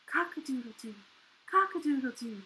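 low, soft, feeble crows; sore-throat rooster. A narrator's reading. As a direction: try to crow with hardly any voice. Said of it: "The rooster tried again".

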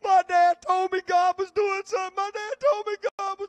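high, loud tearful wail, imitating a young man; imitating crying. Pastor imitating William crying on the phone, high tearful wail. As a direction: cry it out through tears, retelling his call. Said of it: "he just started crying right there on the phone".

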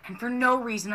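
normal talking voice

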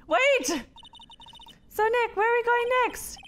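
high cheery small-talk voice; chipper character voice. Acted. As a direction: chirp a cheerful greeting. Same character voice.

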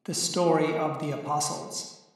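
loud, grand introduction over music; solemn announcer intro. Acted. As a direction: announce it like a herald opening a chronicle. Angel narrator opens the recording.

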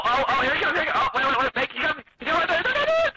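rising, tense excited chatter. Real, not acted. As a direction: build up the panic as he nears. Enemy approaching their hiding spot.

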